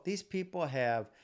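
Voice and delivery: ordinary talk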